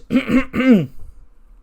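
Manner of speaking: high, loud mock throat clear; haughty throat clear. Charlotte summoning attention with mock throat clear. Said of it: "And she goes".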